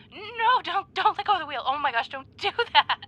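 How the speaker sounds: high, loud alarmed pleading; panicked. Acted. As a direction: beg him not to let go. Panicked about letting go of wheel.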